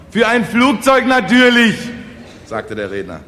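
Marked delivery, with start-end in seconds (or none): 0.1-1.8 very high, loud declamation; emphatic proclamation. Acted. Speaker tells peasants the money's purpose, loudly proclaiming. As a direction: blare out the obvious answer.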